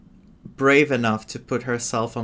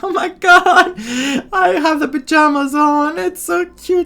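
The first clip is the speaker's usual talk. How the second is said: high, loud squeal of delight at something cute; 7 dB louder than usual. Real, not acted. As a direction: gush over how cute it is. Fangirling over the pajamas outfit.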